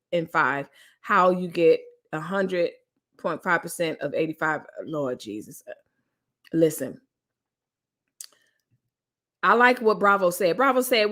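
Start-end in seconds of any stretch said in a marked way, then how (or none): none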